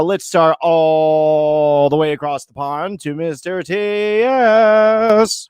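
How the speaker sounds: slow, stretched-out show introduction; announcer introduction. Acted. Host bringing on his friend across the pond, drawn-out announcer intro. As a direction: drag it out like bringing on a star.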